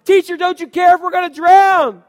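terrified disciples yelling at a sleeping teacher; panicked shout. A narrator's reading. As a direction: scream it over a storm.

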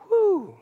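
high, falling "whew"; relieved whew. Acted. Disciples hoping to stay here, a high relieved whew. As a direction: let out a big mock whew of relief.

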